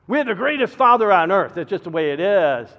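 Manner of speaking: loud, high, with a laugh in it; loud proud declaration. Acted. Pastor boasting of his father to the congregation, loud and proud. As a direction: proclaim it to the whole room. Repeats the line, now shouted.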